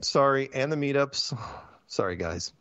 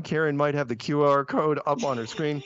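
usual speaking voice

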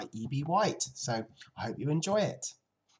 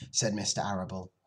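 plain reading voice

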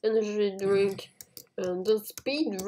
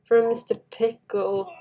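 ordinary delivery

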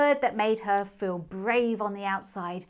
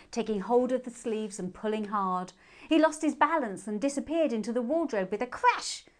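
ordinary delivery

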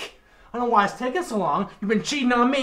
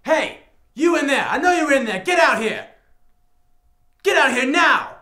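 husband yelling at the hidden man, louder and higher; angry shout; 4 semitones higher, 5 dB louder than usual. Acted. Husband orders the hidden lover out, angry shout. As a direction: bark out the order to come out.

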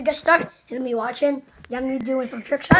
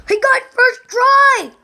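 excited shouting; 10 semitones higher, 9 dB louder, much slower than usual. Real, not acted. Trick shot lands.

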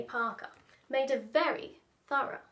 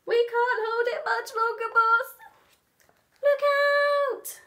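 high strained henchman; straining mouse; 11 semitones higher, 7 dB louder than usual. Acted. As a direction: heave and warn. Mice straining with fridge.

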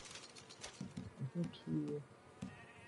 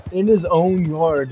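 loud, slow, stressing every word; slow, loud indignation; loud outrage; 2 semitones higher, 8 dB louder, slower than usual. Real, not acted. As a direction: lean into the injustice out loud. Outrage at a robbed man.

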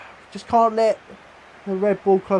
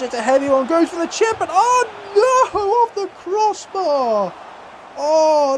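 excited commentary; 10 semitones higher than usual. Real, not acted. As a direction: call the chip like it's going in, then groan. His own chip hits the crossbar.

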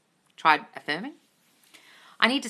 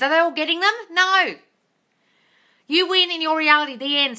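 louder and higher, fed-up scolding; louder, higher exasperated scolding of viewers; exasperated rant; 7 semitones higher, 6 dB louder than usual. Real, not acted. Exasperated rant at silly question.

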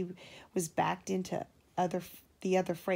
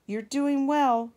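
high, loud, slow cheering on; encouraging call; 7 semitones higher, 9 dB louder than usual. A narrator's reading. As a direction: cheer him up the hill. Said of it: "whistled Edward".

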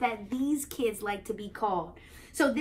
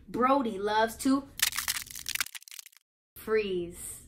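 dramatic frozen pause before freeze; slower than usual. Shown as a demonstration. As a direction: hold a frozen pause, then drop in freeze. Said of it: "You see how I froze?".

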